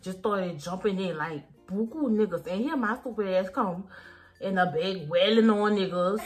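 ordinary talk, nothing marked

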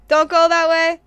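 hammed-up shouted warning; mock warning shout. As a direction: yell a mock warning at full volume. Mock warning yelled during the game.

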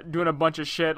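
ordinary delivery

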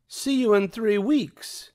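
haughty high Cruella, mild mock shout; Cruella calling out. A narrator's reading. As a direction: call it over the shoulder. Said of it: "Cruella shouted".

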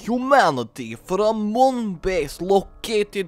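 put-on hammy Transylvanian vampire accent; mock-Dracula accent, relishing the villainy; bad vampire accent. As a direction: play a vampire count with a wobbly Transylvanian accent. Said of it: "my vampire accent is not very good".

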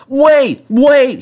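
very high, loud hooting cry; tooting bus. A narrator's reading. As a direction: honk the words out. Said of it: "he tooted".

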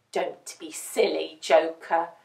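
low, sober puppy voice; grave little thinker; serious puppy. A narrator's reading. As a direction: gently put down a silly idea, low. Serious puppy scolds Joker.

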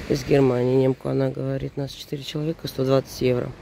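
ordinary talk, nothing marked

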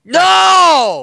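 loud cry of no; desperate shout. Real, not acted. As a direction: yell no in protest. Pleading for friend in game.

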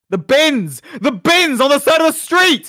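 loud, high exasperated yell; frantic shouting. Real, not acted. Player pointing partner to the bins, frantic shout. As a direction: shout it at someone who won't look.

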